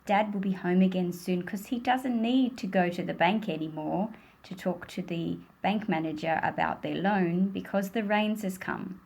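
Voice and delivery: ordinary delivery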